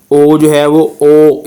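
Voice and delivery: ओ stretched long and rounded; drawn-out o. Shown as a demonstration. Teacher showing the Pinyin vowel o, slow and stretched. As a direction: hold the ओ long like English o. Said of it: "English के ओ की तरह ही आवाज़ देगा".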